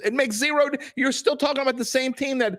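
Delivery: heated, high-pitched insistence; exasperated arguing. Real, not acted. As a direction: voice shooting way up as he insists. Heated argument, talked over.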